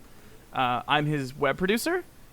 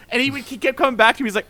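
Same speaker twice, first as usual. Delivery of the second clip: normal talking voice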